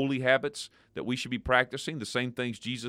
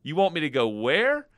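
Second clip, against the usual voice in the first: hammed-up disbelief, higher and louder; mock surprise; 5 semitones higher, 5 dB louder than usual. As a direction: act shocked at the idea. Mock reaction to mission trip.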